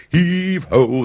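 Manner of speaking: loud, high heave-ho call; sailors' shout. A narrator's reading. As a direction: bellow it across the deck. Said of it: "they shouted".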